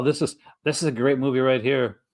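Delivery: ordinary talk, nothing marked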